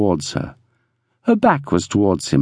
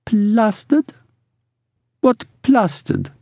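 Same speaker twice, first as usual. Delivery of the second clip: put-on high, questioning accented voice; puzzled woman; 10 semitones higher, slower than usual. A narrator's reading. Ruby asking Brigg about a new word, puzzled. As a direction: ask it high, trying out the word.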